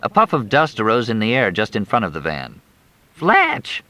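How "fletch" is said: very high shriek of his name; scream. Acted. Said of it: "Crystal screamed".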